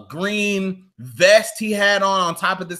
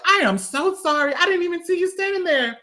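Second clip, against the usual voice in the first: higher, sweet mock-innocent apology; imitating the woman's sorry, higher; 11 semitones higher than usual. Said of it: "She was like".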